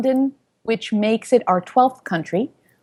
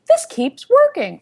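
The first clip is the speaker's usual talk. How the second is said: excited; 11 semitones higher, slower than usual. Retold or imitated. Excited at fifth city.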